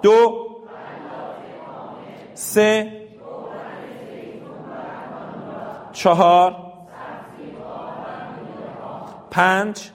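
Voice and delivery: teacher counts aloud for the class; loud group count. Shown as a demonstration. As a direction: call each number out to the class. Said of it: "همه با هم با صدای بلند".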